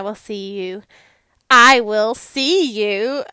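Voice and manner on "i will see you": louder and slow, rising at the end; drawn-out sign-off. Real, not acted. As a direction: stretch it out like a cheery goodbye call. Sign-off restarted for emphasis.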